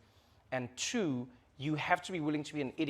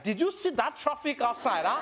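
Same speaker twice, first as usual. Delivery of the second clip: high, animated Nigerian accent; big, pitched-up Nigerian accent; 8 semitones higher, 3 dB louder than usual. Shown as a demonstration. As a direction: exclaim it with a lively Nigerian accent. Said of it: "when Nigerians come inside".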